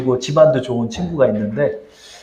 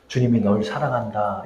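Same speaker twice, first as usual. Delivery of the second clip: soft divine message; 6 semitones lower, 8 dB quieter than usual. Retold or imitated. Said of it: "이 말 좀 전해주라는 거예요".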